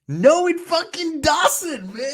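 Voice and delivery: high-pitched laughing yell at the screen; angry outburst. Real, not acted. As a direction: blurt it out angry, half laughing, slowly. Reacting furiously to the video.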